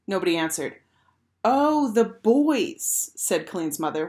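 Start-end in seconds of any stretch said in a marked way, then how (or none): 1.4-3.1 put-on high, loudly stretched "oh, the boys"; slow, raised-pitch dawning realisation; drawn-out realisation. A narrator's reading. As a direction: draw it right out as it dawns on you. Mother notices who is missing.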